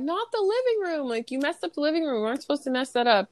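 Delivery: high-pitched, hurried pleading; acted high-voiced dismay; mock protest. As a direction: imitate upset kids objecting in a rush. Retold children protesting about the mess.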